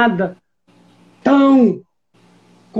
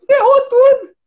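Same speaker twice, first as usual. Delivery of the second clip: high, loud outcry; dramatic exclamation; 13 semitones higher, 4 dB louder than usual. Real, not acted. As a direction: shout it like everything's ruined. Exclaims the bad outcome. (exclamação alta e dramática)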